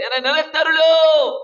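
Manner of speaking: high, loud pleading cry to God; plea cried high and loud. Acted. Preacher voices Hezekiah's plea.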